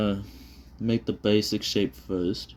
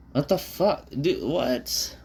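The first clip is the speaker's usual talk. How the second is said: startled disbelief; 8 semitones higher, much slower than usual. Real, not acted. Shocked at own drawing.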